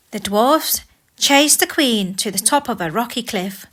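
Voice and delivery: plain reading voice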